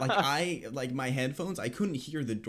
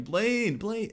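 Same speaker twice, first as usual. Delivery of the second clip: higher, louder call of a name; 9 semitones higher, 4 dB louder than usual. Retold or imitated. Friends call a name to get his attention, mock call. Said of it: "they're like".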